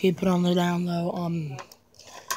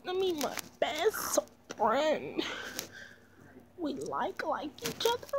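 much higher put-on friend voice; high girly voice; 11 semitones higher, slower than usual. Kid playing sad best friend Brittany. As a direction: pitch up into a squeaky character.

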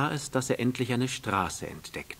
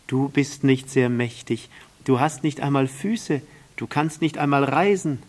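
put-on loud, lively child-prince voice; lifted, emphatic storybook prince; little prince, teasing; 4 semitones higher, 8 dB louder than usual. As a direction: challenge the snake with rising energy.

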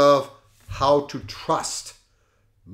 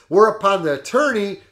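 ordinary delivery

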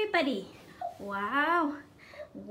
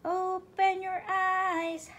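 slow gentle; much slower than usual. Pretend. Slowly waking the doll.

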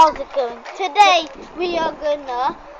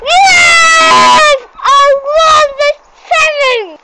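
higher, louder victory yell; excited shout; 11 semitones higher, 8 dB louder, much slower than usual. Real, not acted. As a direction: cheer out the win. Winning the challenge.

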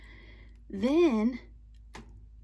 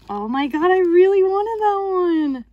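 much louder and higher, thrilled; excited shout; 9 semitones higher, 14 dB louder than usual. Real, not acted. To viewers, revealing another wished-for figure, loud excited cry. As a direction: burst out in delight.

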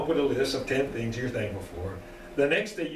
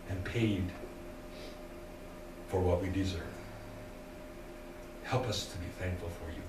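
softer, lower closing prayer voice; 6 semitones lower, 5 dB quieter than usual. Acted. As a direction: pray quietly and humbly.

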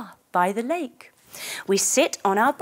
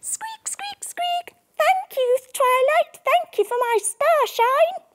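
much higher tiny squeaky mouse voice; high mouse voice thanking the unicorn. A narrator's reading. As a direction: squeak like a little grateful mouse. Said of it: "Matilda the mouse goes".

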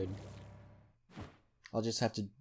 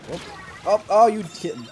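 ordinary talk

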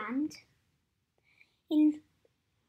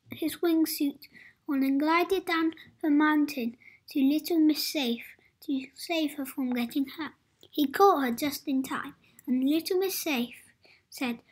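plain reading voice

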